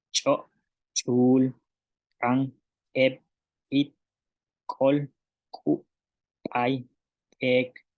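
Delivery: first consonant of each word from "Chak" to "puus" popped as an ejective; isolated model words like "Chak" and "puus", glottal burst on the opening consonant; glottalised consonants. Shown as a demonstration. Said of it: "que llevan glotal".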